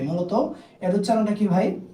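ordinary delivery

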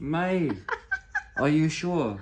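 laughing. Real, not acted. Learner laughs while asking.

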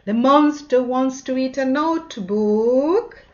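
usual speaking voice

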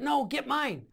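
high, emphatic brush-off; retold refusal. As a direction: imitate a comrade refusing under fire.

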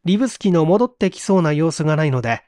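ordinary delivery

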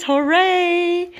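high, drawn-out hooray; high-pitched little celebration; playful cheer. Real, not acted. Cheering for orange-loving dog.

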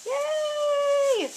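very high, gleeful yay; excited squeal. Real, not acted. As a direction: squeal with delight. Cheering about the fish tank.

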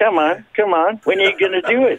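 playful imitation of a friend's dare; goading. Acts out friend goading him.

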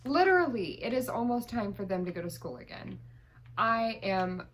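usual speaking voice

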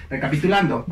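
ordinary delivery

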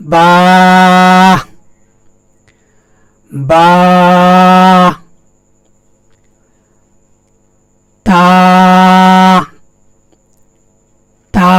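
each letter said alone, long pauses between; teacher's letter drill. Shown as a demonstration. Teacher modelling letters for students to copy. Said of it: "এভাবে আপনি বারবার practice করেন".

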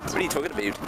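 mock Canadian accent. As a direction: put on a comic Canadian accent. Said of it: "He said in Canada".

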